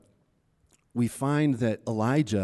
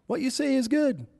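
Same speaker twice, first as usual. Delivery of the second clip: crowd agreeing; 11 semitones higher than usual. Retold or imitated. People voiced, second take higher.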